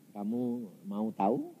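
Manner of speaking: low, soft, slow question; voice of God. Retold or imitated. Preacher voices God offering him an answer, low and soft. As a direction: ask it gently, deep and unhurried. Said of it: "Tuhan berkata apa".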